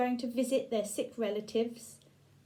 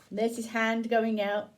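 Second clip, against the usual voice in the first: plain reading voice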